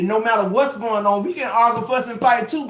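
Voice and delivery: usual speaking voice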